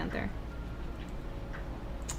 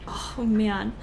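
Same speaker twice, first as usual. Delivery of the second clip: usual speaking voice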